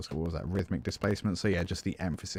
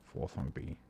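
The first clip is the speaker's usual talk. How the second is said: fading to a whisper. Shown as a demonstration. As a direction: let the voice die away into a whisper. Counting notes down, fading out.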